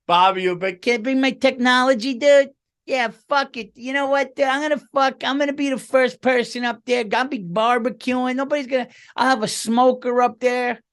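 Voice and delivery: gleeful high mock Bobby planning a barbecue; Bobby impression. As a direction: keep the excited buddy voice going. Keeps voicing Bobby.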